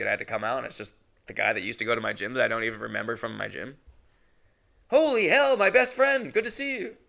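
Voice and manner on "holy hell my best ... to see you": much higher, hammed-up fake joy; over-the-top fake delight; mock overjoyed greeting. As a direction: gush like you're thrilled to see him.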